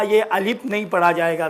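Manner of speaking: ordinary talk, nothing marked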